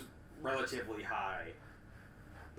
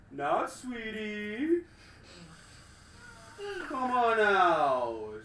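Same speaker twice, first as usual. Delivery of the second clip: big resounding voice, slow and loud; heavy booming voice; 6 semitones higher, 7 dB louder, much slower than usual. Acted. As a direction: boom it out across the field, slow. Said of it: "you all do hear this heavy, booming voice".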